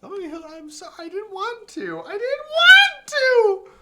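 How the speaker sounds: rising to a very high, loud slow wail; anguished wail. Acted. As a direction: wail it out in despair. Wailing character's despair.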